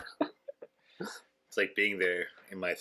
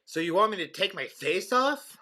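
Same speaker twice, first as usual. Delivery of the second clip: slow, high, incredulous parody; mock movie dialogue; 10 semitones higher than usual. Joking imitation of a film star before surgery, exaggerated disbelief. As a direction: draw out the question, pitched well up.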